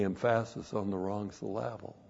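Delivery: "emphasis" said em-FASS-iss, "syllable" suh-LAB-ul; misplaced stress. Shown as a demonstration. Preacher admits his mistake, "emphasis" and "syllable" stressed on second syllables. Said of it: "That's what I was doing".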